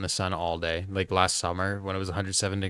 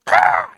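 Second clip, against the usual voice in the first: loud imitated hawk screech; 13 dB louder than usual. Mom hears a hawk in the backyard, loud screech. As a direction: shriek it out like a hawk. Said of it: "all she heard was a hawk go".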